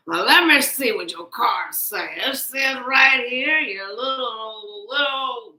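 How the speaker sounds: playing a grumpy old man, teasing; Uncle Ruckus voice. Tarot reader teasing viewer in a mock Uncle Ruckus voice. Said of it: "Sound like Uncle Ruckus".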